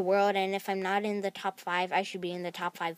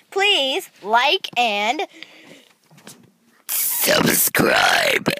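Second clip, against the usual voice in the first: belch the words out slowly; burp talking; 3 semitones higher, 11 dB louder, much slower than usual. Shown as a demonstration. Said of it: "subscribe in a burp voice".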